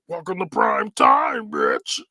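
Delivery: low, slow menacing Freddy growl; Freddy catchphrase. Retold or imitated. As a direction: drag it out low like the killer. Freddy grabs the girl, famous line.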